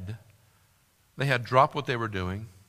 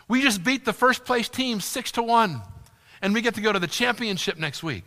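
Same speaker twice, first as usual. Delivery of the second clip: put-on a child's eager rush of good news, raised high; excited kid; 9 semitones higher, 5 dB louder than usual. Son telling parents about the win, excited. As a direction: still buzzing with the win. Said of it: "he's full of excitement".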